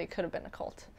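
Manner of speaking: hushed, unvoiced confession; whispered aside. Real, not acted. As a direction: drop to a secret whisper. Whispered aside about a cult.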